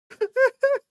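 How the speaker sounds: high burst of laughter; loud laugh. Real, not acted. Laughs at his own remark.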